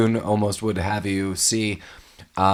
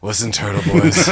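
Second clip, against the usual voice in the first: Jack Palance; 7 dB louder than usual. Pretend. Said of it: "Jack Palance was the voice of Splinter".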